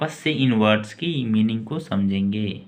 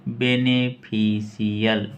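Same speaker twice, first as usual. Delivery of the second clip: Beneficial stretched slowly; slow careful; much slower than usual. Shown as a demonstration. Teacher to students after spelling, slow careful pronunciation. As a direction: draw out beneficial syllable by syllable.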